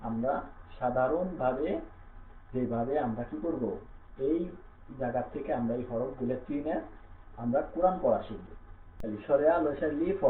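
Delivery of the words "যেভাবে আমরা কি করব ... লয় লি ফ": usual speaking voice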